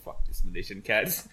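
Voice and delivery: snooty cat voice. Acted. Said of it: "two cats are talking".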